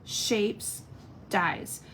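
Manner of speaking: slow, careful Stylish Shapes, each word drawn out; deliberately slow product name; said slowly. Shown as a demonstration. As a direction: spell out Stylish Shapes slowly so it comes out right. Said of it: "I gotta say it slow".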